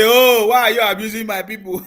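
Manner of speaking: mock offended cry. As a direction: mimic the wounded critics, pushing it loud. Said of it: "You say".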